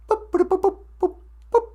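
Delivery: tiny high-pitched boops; high little electronic boop noises; imitated computer beeps. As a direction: mimic a computer chirping as it processes. Imitating a computer starting a program.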